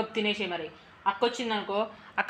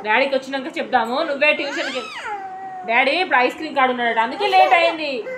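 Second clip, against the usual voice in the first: louder, higher coaxing voice; 10 semitones higher, 10 dB louder than usual. Real, not acted. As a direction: coax her out of tears, raising your voice over the crying. Mother soothing crying daughter.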